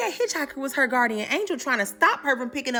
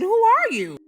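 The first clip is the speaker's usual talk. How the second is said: higher, louder; narrator's leaning-in echo; 8 semitones higher, 5 dB louder, slower than usual. Real, not acted. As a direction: demand it again, hooked on the story. Narrator echoes.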